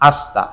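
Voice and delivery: আস্তা as ash-ta, no vowel after 'sh'; silent i. Shown as a demonstration. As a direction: run 'sh' straight into 'ta' in আস্তা. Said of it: "C এর পরের ই উচ্চারণটা হয় না".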